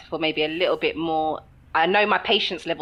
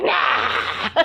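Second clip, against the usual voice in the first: higher, mock demon snarl; demon outburst; 5 semitones higher than usual. A demon speaks for the impatient girlfriend, mock demon outburst. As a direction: let a playful demon burst out of you. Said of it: "it's like this demon crawls out".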